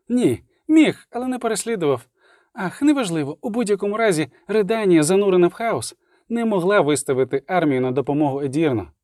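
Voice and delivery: high-pitched bard telling news; bard's voice. Acted. Любисток briefing the witcher, high-pitched bard voice. Любисток figure in story dialogue.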